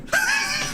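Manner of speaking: high laughing burst; burst of laughter. Real, not acted. Man cracking up at the speaker's joke, high laugh.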